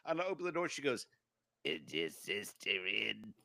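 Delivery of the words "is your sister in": put-on low, slow old smoker lady voice; raspy old lady. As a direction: drag it out like a lifelong smoker.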